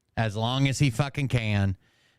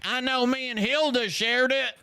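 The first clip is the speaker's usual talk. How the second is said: high squeaky mocking falsetto; mock Robert; 11 semitones higher than usual. Heckler imitating Robert talking about shares, mocking falsetto. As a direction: parody a viewer in a silly high voice. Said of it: "And Robert's like".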